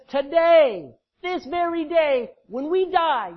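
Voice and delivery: high, loud, drawn-out preaching; loud emphatic proclamation. Acted. Preacher voicing Jesus' promise to the saved thief, loud and emphatic. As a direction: belt out each phrase, stretching the key words.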